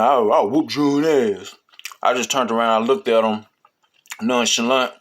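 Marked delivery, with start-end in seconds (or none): 0.0-1.5 slow, drawn-out cocky boast through a grin; lightly voiced smug kid bragging with a grin; a hint of a cocky grin, slowed right down; grinning tough talk. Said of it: "crooked ass little gap tooth smile".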